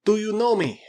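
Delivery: high, loud, urgent appeal; pleading Russian. Acted. Krasky asks the strangers if they know him, high and pleading. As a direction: call out to be recognized, pitched high.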